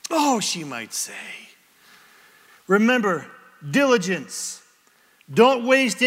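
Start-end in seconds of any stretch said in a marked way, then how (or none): 0.1-0.4 high, drawn-out "oh"; wife's mock reaction. Wife responding to husband's question, exaggerated surprise.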